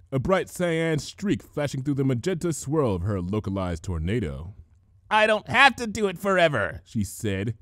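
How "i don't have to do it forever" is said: straining. A narrator's reading. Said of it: "teeth tight with effort".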